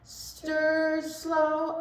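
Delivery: ordinary talk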